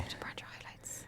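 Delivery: barely audible, voiceless whisper; mock whispered order. Co-host nagging about branded highlights, whispered. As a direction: whisper the order under your breath.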